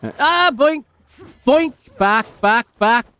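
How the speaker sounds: high loud game cries; excited yelps. Real, not acted. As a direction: yelp each move out. Game move exclamations.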